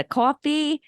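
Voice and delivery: Long Island "cawfee" vowel; Long Island accent. Acted. Former child auditioner jokes along, Long Island accent. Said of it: "being from Long Island".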